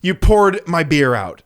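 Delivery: loud, slow, seething dad; slow angry father voice; fuming dad. Retold or imitated. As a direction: let the anger build word by word. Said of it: "dad starts getting, like, fuming".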